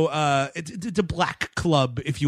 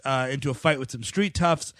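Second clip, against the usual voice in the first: ordinary talk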